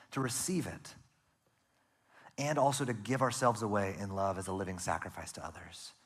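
ordinary talk

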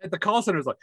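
normal talking voice

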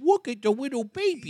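put-on high, sing-song cooing at a baby; mock the gushing baby voice; mock baby talk. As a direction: coo over an imaginary infant. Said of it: "That little stupid voice".

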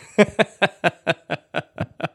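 amused burst of laughter; real laugh. Real, not acted. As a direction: let the laugh roll out, tickled. Laughing at his own imagined fall.

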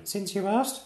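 put-on a small boy boasting bravely; piping kid knight proclaiming; little knight. A narrator's reading. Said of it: "said Tiny".

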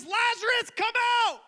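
loud, very high commanding cry; calling out. Acted. Preacher voicing Jesus at the tomb, loud calling out. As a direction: shout it as if summoning the dead.

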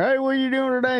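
high-pitched mock Southern greeting; Southern accent. Imitating locals at the boat ramp, Southern accent. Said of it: "sorry to throw that Southern accent out there".

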